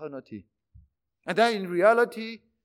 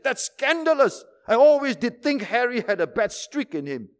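higher, louder scandalized gasp; mock outrage; 6 semitones higher, 6 dB louder than usual. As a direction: play up the outrage. Shocked gossip in joke.

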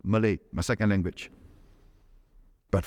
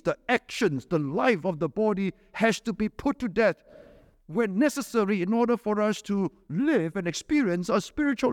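high, pressing sermon voice; fervent preaching; 8 semitones higher than usual. Acted. Preacher warning believers about the body's desires, impassioned. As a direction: drive the warning home.

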